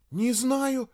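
put-on high, bewildered girl's reply; flustered. A narrator's reading. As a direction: pitch it up, at a loss. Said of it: "растерялась девушка". (растерянно, высоким голосом)

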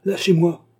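loud, high shout to let go; angry cry. A narrator's reading. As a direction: cry out as if breaking free. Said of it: "cria le nouveau venu".

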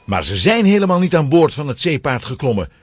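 usual speaking voice

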